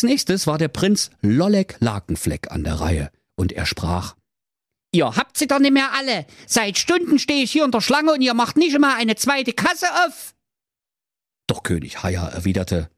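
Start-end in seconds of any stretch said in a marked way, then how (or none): 4.9-10.3 very high, nagging suitor; high indignant prince; whiny prince. Acted. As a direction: complain like someone stuck in a queue. Impatient prince complains.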